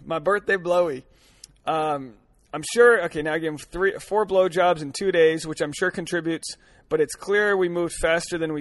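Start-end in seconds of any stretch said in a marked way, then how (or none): none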